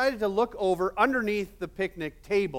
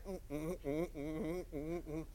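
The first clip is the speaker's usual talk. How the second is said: muffled fly hums; 13 dB quieter than usual. Acted. Fly wrapped up in web.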